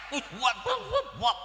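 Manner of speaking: mock sobbing, words choked and garbled; holding back tears. Grown child calls to a parent at Lebaran, blubbering through mock tears. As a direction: blubber it out in broken bursts. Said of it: "Kok nahan nangis, ngomong kagak danta?".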